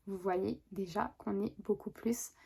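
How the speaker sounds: whisper. Real, not acted. Showing the evening look.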